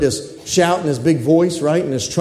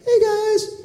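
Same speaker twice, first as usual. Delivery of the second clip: tiny high, soft mock voice; casual little greeting; 13 semitones higher, 5 dB quieter than usual. Preacher mocking an unimpressive archangel, tiny high voice. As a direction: squeak it out as a comically puny hello. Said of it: "I don't think it's gonna be like".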